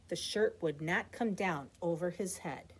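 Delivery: ordinary delivery, nothing marked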